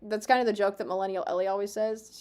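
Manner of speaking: normal talking voice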